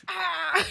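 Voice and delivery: high imitated monster scream; mock pained scream. Imitating the Cyclops hit in the eye, mock scream. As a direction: yelp as if struck by an arrow.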